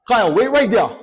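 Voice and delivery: loud security guard command; guard ordering Kyle to freeze; stern order. Retold or imitated. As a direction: stop him with a firm shout.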